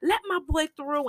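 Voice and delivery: high, beaming call to the crowd; a happy boss clearing the way for his boy. Retold or imitated. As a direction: wave him through with delight. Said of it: "Sonny is so happy".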